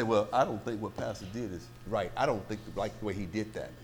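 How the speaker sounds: low, quiet mock complaint; subdued objector, pitched well down; grumbling church member. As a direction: imitate a member privately doubting the pastor. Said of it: "you're gonna try to say".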